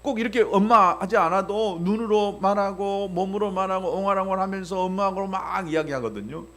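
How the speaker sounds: ordinary delivery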